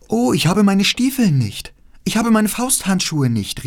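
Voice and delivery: put-on high, loud cry of a frightened little girl; a child realising in the freezing cold that her boots are gone. A narrator's reading. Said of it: "rief die kleine Gerda".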